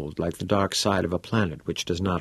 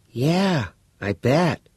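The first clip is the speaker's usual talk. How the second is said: put-on bright, curious teenage boy; a keen schoolboy pressing for details; eager boy voice; 8 semitones higher, much slower than usual. Todd replying.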